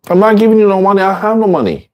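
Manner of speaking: high, fast mock scolding; scolding refusal. Teacher voicing a friend scolding an addict asking for money, high and fast.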